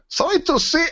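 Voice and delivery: high-pitched mock German accent. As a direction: put on a silly, high German voice. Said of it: "shouldn't do it in that accent".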